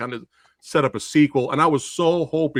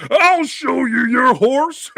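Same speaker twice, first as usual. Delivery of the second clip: higher, slow hammed-up henchman taunt; henchman's threat; 8 semitones higher, 4 dB louder, much slower than usual. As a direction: ham up the villain's threat, drawn out. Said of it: "henchman ... goes".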